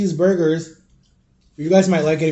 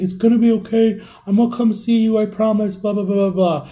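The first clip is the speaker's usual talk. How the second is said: higher, lightly voiced brother, soothing then trailing into blah blah; mock the brother's easy promises, a bit higher; imitating big brother; 5 semitones higher than usual. Big brother comforting crying five-year-old.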